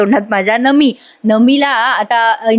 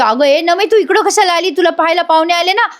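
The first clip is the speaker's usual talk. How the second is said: higher, startled friend scolding lightly; lightly voiced surprised buddy; 7 semitones higher than usual. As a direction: friend startled that the bride ran off. Panya surprised Nami came.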